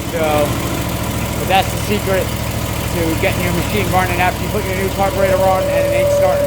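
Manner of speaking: loud and high over the engine noise; shouting over engine. Real, not acted. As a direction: call out over a running motor.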